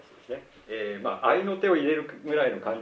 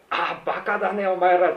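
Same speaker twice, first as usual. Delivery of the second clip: higher, loud, jaunty bark at the family; 寅さん風の威勢のいい啖呵; Tora-san's tirade; 8 semitones higher, 8 dB louder than usual. Retold or imitated. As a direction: fire it off like a street-smart uncle. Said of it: "啖呵を切ってですね".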